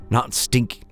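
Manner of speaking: plain reading voice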